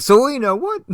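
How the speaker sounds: high, loud shocked cry of the name; shocked gasp. Acted. Batman stunned as Catwoman unmasks, loud shocked cry. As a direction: blurt it out stunned.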